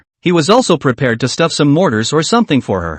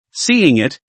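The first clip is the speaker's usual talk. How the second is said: ordinary delivery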